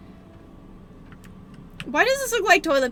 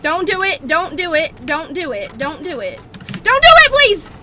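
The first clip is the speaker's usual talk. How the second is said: rising panicked pleading; being chased; 9 dB louder than usual. Real, not acted. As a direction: beg harder and harder until a scream. Pleading as enemy nears.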